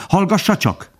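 high-pitched, eager urging; excited cry. A narrator's reading. Bambi urging the old stag to listen, excited. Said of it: "kiáltott Bambi izgatottan".

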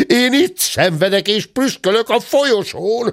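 high-pitched wail of complaint; indignant outburst. A narrator's reading. Suffering gentleman in the corridor, indignant outburst. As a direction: protest high, working up to a sneeze. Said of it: "tört ki a tekintetes úr".

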